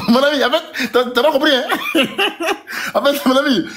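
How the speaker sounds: real laughter breaking between the words; amused disbelief. Real, not acted. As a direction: chuckle through it at a rival who missed the point. Laughs at opponent's misunderstanding.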